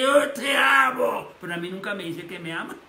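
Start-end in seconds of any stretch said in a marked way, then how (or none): 0.0-1.3 put-on slow, loud drunken shout of love; drunk father. Drunk father to his son, slow loud shout. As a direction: drawl it out loud like a drunk dad.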